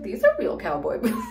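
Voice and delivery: words breaking into a laugh; laughing as she quotes herself; laughing retort. Retold or imitated. To Victor about her boots, laughing. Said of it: "He's like".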